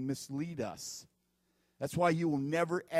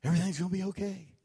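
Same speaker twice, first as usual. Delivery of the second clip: mock radio preacher; 4 semitones higher, 6 dB louder than usual. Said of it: "I hear these people".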